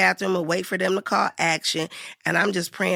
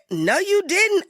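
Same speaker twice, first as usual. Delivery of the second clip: high-pitched retold disbelief; Whoopi Goldberg; 11 semitones higher, slower than usual. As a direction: call out the newcomer in disbelief. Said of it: "And Whoopi Goldberg said".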